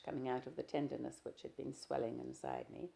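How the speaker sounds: ordinary delivery, nothing marked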